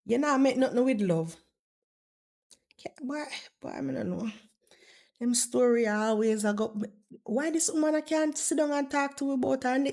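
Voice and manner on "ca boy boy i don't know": very soft, half-whispered aside; hushed, at a loss; under the breath. Real, not acted. Gives up on Chantal, under breath.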